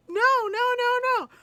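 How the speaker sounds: high, loud mock-panicked brother; panicked denial. Brother caught smoking by mom, high panicked denial. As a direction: imitate flustered, squealing denial.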